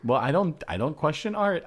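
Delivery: ordinary talk, nothing marked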